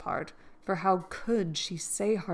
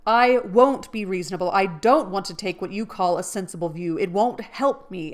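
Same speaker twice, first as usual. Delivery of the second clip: loud, stubborn protest; defiant; 7 dB louder than usual. Acted. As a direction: refuse stubbornly. Laurie defiant.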